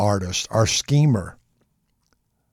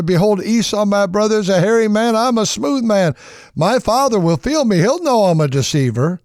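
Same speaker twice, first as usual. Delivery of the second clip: put-on anxious young Jacob; 8 semitones higher than usual. Jacob fretting to his mother about the plan, higher and anxious. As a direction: plead with mother, fretting about being caught.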